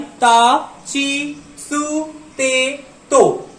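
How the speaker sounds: का की कु के को drawn out one by one; slow syllable demo. Shown as a demonstration. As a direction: read का की कु के को slowly like a teacher. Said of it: "ये प्रोनाउंसिएशन बहुत इंपॉर्टेंट होता है".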